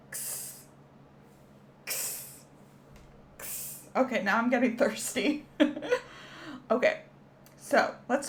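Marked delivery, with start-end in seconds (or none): 0.1-3.8 can fizz hiss. Shown as a demonstration. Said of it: "that kind of makes that sound".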